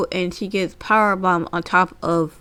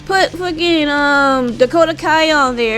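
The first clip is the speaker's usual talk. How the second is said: mocking rant; 6 semitones higher, 3 dB louder than usual.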